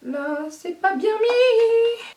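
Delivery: high, drawn-out whiny complaint; sing-song whine. Real, not acted. Vlogger whose lash sits wrong, high whine. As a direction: stretch it upward into a whine.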